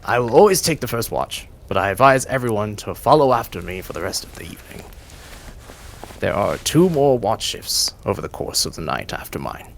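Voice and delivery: the GM giving the leader a touch of authority; McGuffery addressing the group. Acted. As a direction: announce the watch order to the group. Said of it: "to address you and goes".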